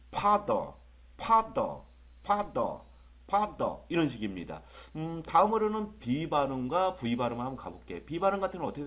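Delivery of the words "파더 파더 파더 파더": 파더 with a burst on the first syllable; bursting F sound. Shown as a demonstration. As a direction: burst out 파더, then pause. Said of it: "터쳐줘야 되겠죠?".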